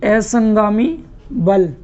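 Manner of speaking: "असंगामी बल" slowed right down and stretched; teacher's slow drill of "असंगामी बल"; slow repeat. Shown as a demonstration. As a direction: repeat "असंगामी बल" slowly so students can write it. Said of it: "Hindi में कहते हैं इसको".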